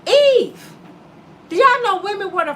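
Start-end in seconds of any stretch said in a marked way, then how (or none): 0.1-0.7 Eve! called out loud; exasperated shout. Acted. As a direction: yell her name in disgust. Calling out Eve's name.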